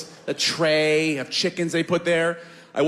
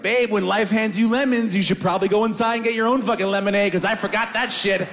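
cocky mock wisdom; 6 semitones higher than usual. Cocky retort.

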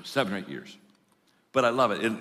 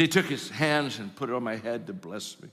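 normal talking voice

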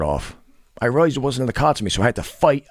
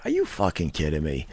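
normal talking voice

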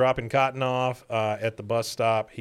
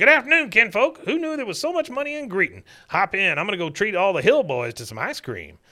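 put-on boastful old man; 8 semitones higher than usual. Cotton showing off to the Hill family, boastful codger voice. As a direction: gloat like a rich old man.

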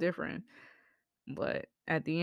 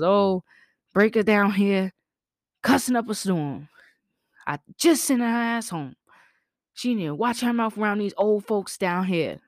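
put-on higher, louder gossiping old neighbor; complaining neighbor; 4 semitones higher, 5 dB louder than usual. Neighbor phoning grandma to report cussing, higher and louder. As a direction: tattle on the kid to grandma.

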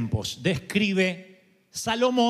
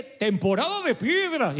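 mock announcement; 4 semitones higher than usual. Preacher illustrating seasons to congregation, mock announcement. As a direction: call it out like an announcer.

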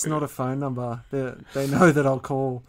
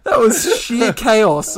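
laughing; 7 semitones higher, 8 dB louder, much slower than usual. Real, not acted. Laughing hard at the attempt.

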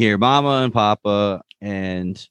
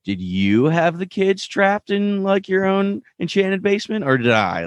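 mock-sarcastic question; 12 semitones higher than usual. Co-host retelling Agatha taunting Wanda over the trapped kids, mock-sarcastic. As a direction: imitate a villain feigning innocence, voice way up.

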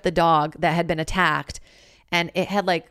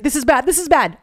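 higher and louder, alarmed; retold alarm as the dog bolts; panicked; 8 semitones higher, 6 dB louder than usual. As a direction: imitate a friend's panic. Friend panics as dog escapes.

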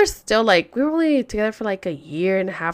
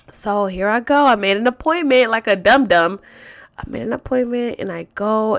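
usual speaking voice

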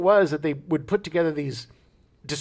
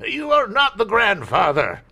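slow, louder host reveal; hammed-up Maury announcement; 7 semitones higher, 4 dB louder than usual. Joke variant of reveal.

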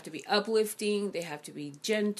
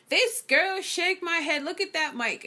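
higher and louder, sneering imitation; mocking scorn; 10 semitones higher, 5 dB louder than usual. The troll mocking her mic on YouTube, loud sneering imitation. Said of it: "was like".

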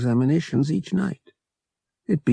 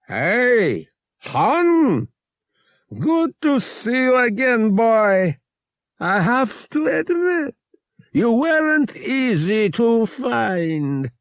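much higher and louder, a hearty menacing greeting; jovial captain; 12 semitones higher, 4 dB louder, slower than usual. A narrator's reading. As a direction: boom it out like an old friend holding a gun. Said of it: "said jovially".

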